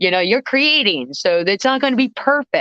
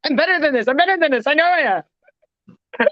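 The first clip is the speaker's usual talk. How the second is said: frantic pleading; 7 semitones higher, faster than usual. Retold or imitated. Panicked self after hearing recording.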